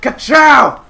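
slow, loud build to a high shout; mock-heroic cry. Player calling lightning on foes, loud heroic shout. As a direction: proclaim your power, then yell.

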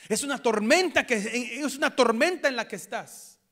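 high, loud cry on tormenta; fervent preaching at full force; preaching shout. Real, not acted. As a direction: belt it out to the congregation.

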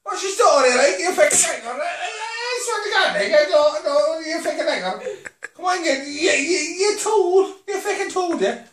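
put-on quick, laughing Cork accent. Asked to do Cork, answers in a Cork accent. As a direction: rattle it off like a riled Cork lad. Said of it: "No, do Cork".

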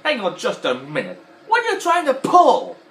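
rising to a high, loud, slow demand; suspicious, then yell it; loud, high slow suspicious toy voice; Mister Potato Head impression. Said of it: "he went like this".

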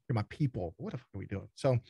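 normal talking voice

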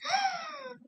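high shocked gasp; sharp high gasp. Shown as a demonstration. Skylar reacting to the principal's office, high gasp. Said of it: "Skylar take".

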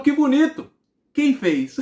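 loud, high-pitched mock amazement; astonished mother. Mother doubting her son drew it, loudly amazed. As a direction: exclaim the praise and question loudly, near laughter.

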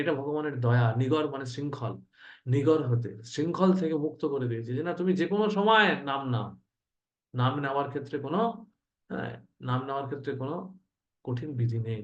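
ordinary talk

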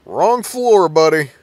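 loud, high jeering call; taunting shout. Real, not acted. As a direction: yell it at the monster, cocky. Taunting enemy in horror game.

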